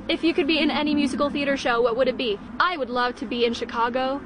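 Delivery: ordinary delivery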